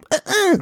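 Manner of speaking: high strained grunts; imitated child noise. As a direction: mimic the kid's noise. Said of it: "ein Kind getroffen und macht".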